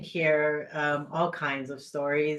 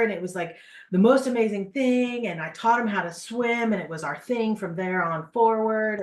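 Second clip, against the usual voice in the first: higher, louder, bubbling over with excitement; gushing client; 6 semitones higher, 6 dB louder than usual. Retold or imitated. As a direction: retell it like the best day ever. Client gushing about camping memory.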